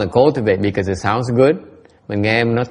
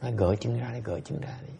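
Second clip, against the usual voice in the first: fading to a bare whisper; ghost's whisper; 12 dB quieter than usual. Acted. A ghost whispering in the listener's ear, fading to a whisper. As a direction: coax it into the ear, dying away. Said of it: "cái con quỷ nó tới".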